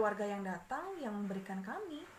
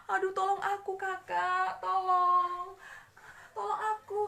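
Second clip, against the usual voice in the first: high loud pained cries; begging through the pain; screaming for help; 7 semitones higher, 9 dB louder, slower than usual. Acted. Suffering child screams to older sibling for help. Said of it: "si adik terus saja menjerit-jerit".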